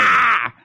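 short high yelp; high strangled yelp. Retold or imitated. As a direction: squeal as if cut off. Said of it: "your finger would be like".

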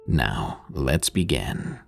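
low, soft, slow sinister lead-in; ominous late night radio. Acted. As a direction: drop low and linger. Host's ominous sign-off into story.

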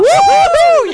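very high loud woo-hoo; party whoop. Acted. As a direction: whoop like a drunk partier.

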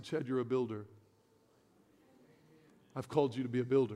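hushed, low voice of God; soft whisper of God. Retold or imitated. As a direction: drop way down as if God speaks into the heart. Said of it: "I heard God whisper".

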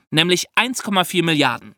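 normal talking voice

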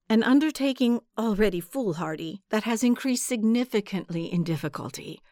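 plain reading voice

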